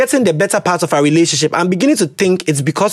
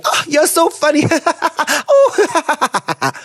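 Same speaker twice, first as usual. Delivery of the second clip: mock giggle; 9 semitones higher, much slower than usual. Mocking girl laughing falsely.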